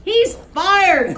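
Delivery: high, loud insistence; insist, loudly; furious boss insisting; insistent. Acted. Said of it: "Cleve insisted".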